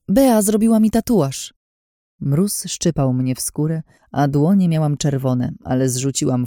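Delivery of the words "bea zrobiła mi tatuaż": high, eager exclamation; cry of discovery. A narrator's reading. Heroine proving her identity to a friend, excited cry. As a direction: announce the proof you just remembered. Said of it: "krzyknęłam odkrywczo".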